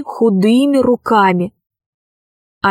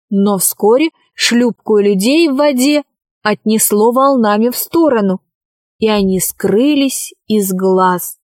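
plain reading voice

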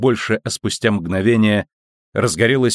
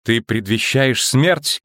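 usual speaking voice

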